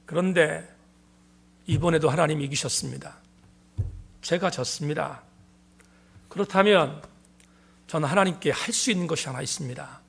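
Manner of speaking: ordinary delivery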